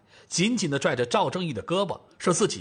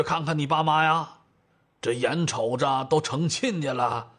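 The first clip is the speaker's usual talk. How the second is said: put-on old father, hesitant. Father asking to meet the in-laws, tentative elder's voice. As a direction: feel your way politely into the request.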